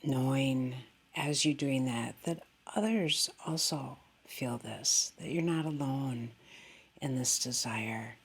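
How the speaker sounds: calm, unhurried meditation voice with long pauses; guided meditation. Real, not acted. Meditation teacher guiding listeners into goodwill and breath, calmly. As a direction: settle gently downward at the ends of phrases.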